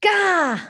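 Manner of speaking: high startled gah; startled gasp. Acted. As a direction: jump with fright. Mouse startled by reflection.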